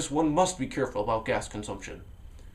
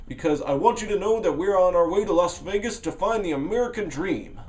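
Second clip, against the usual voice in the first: manic narrator voice; 6 semitones higher, 4 dB louder than usual. Acted. Narrator explaining to scared hitchhiker.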